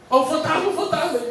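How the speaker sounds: high-pitched alarmed cry; mock panic. Peter spotting Jesus from the boat, mock panic. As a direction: cry it out high, hammed-up fright. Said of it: "o que é que ele diz?".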